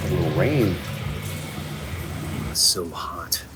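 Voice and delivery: ordinary talk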